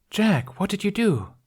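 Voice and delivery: high, sharp demanding voice. A narrator's reading. The woman confronting Jack, high and demanding. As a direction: call him out, exasperated. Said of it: "the woman demanded".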